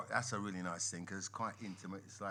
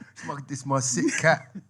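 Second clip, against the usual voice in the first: laughing while quoting; 6 semitones higher, 15 dB louder than usual. Retold or imitated. Quoting his EastEnders line.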